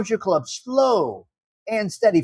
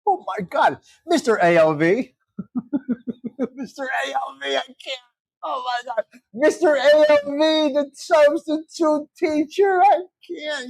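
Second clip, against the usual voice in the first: breathless laughing through words; 3 semitones higher than usual. Real, not acted. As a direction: crack up while talking. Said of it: "You guys are killing me".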